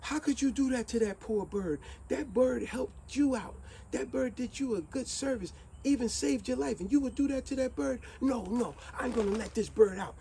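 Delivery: wife scolds her husband for the bird; scolding wife. A narrator's reading. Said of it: "And now the wife says".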